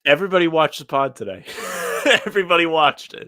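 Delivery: loud, high, breaking into laughter; amused exclamation. Real, not acted. Laughing that everybody watched pod.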